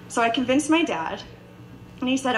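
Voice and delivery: normal talking voice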